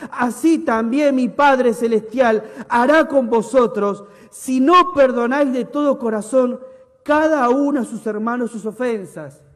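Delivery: a preacher thundering the verse; preaching. Acted. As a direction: proclaim it to the congregation. Preached scripture reading.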